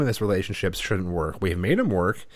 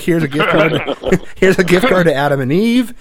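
laughing mock gift; 4 semitones higher, 6 dB louder than usual. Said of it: "I'm gonna be like".

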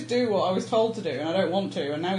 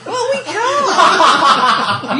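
laughing; 11 semitones higher, 10 dB louder, much slower than usual. Real, not acted. Laughing retort in argument.

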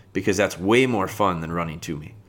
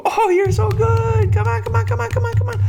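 excited puppy praise; 5 dB louder than usual. Shown as a demonstration. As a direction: reel the puppy in with over-the-top excitement. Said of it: "reel that puppy in with that excitement".

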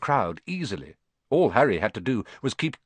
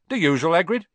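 louder, higher friendly barman; barman's greeting; 5 semitones higher, 5 dB louder, faster than usual. A narrator's reading. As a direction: call out the regular's order across the bar.

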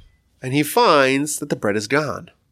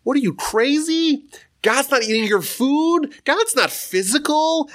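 much higher, mock outraged rebuke; hammed-up indignant rabbi; rabbi freaking out; 12 semitones higher than usual. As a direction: scold him in disbelief. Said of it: "The rabbi freaks out".

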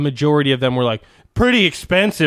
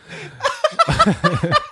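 very high wheezing laugh; helpless laughter. Real, not acted. Reader losing it over a silly review, high helpless laugh. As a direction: crack up completely.

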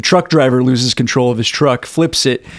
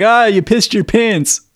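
high, teasing jeer; mocking friends; 9 semitones higher, slower than usual. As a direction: taunt him like a teenage jock. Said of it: "They're like".

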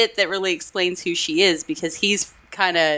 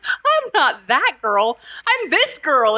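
slow, higher, punching that and this; pointed contrast; 8 semitones higher, 3 dB louder, slower than usual. Retold or imitated. Actress correcting the pilot, slow pointed contrast. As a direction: lean on "that" then "this", slowly. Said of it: "I love her intonation on that line".

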